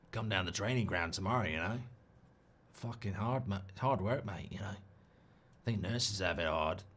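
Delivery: low, quiet, weary Australian manager grumbling; Australian manager impression. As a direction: slump into a tired Aussie gaffer moaning.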